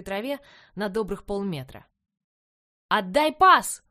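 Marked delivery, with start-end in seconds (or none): 2.9-3.8 loud, high barking coach; shouting coach. A narrator's reading. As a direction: bark the order across the field. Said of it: "закричал тренер".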